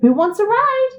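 high, rising little truck voice; high-pitched storybook offer; little truck's invitation. A narrator's reading. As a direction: cheerfully invite everyone aboard. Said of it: "said Blue".

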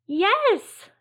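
high, loud burst of joy; excited cry. A narrator's reading. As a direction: shout yes with delight. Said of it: "they cried".

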